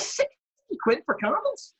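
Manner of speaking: very high, slow disbelieving echo; incredulous. Retold or imitated. Manager hearing the candle price, incredulous high retelling. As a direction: stretch it out in mock astonishment, voice soaring.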